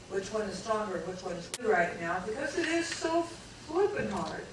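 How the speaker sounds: normal talking voice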